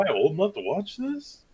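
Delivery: high, uneasy retold question; hammed-up nervous wondering; mock worry. Retold worried reaction to scene.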